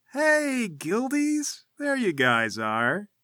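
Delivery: high, drawn-out jovial hail; big, high "hey, pals" greeting; fake-jovial greeting. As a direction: greet them with a wide fake smile. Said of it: "smile is wide and unmistakably jovial, but it looks completely fake".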